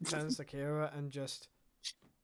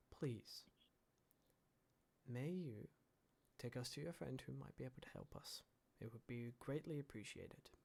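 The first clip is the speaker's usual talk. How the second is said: softer, polite, gentle request; very gently; 3 semitones lower, 12 dB quieter than usual. Acted. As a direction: ask them kindly and softly, like a respectful traveller. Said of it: "very gently".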